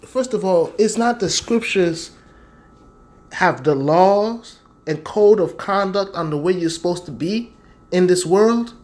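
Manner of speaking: ordinary talk, nothing marked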